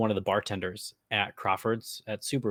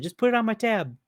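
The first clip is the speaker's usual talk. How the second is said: mock regular; 12 semitones higher than usual. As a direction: act like the place knows you. Playing the big-shot regular.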